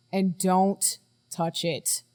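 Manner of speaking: slow, spaced-out parting warning; deliberate warning. Acted. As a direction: draw it out word by word for emphasis. Parting warning about the device.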